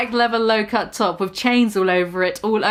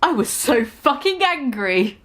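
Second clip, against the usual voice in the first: ordinary delivery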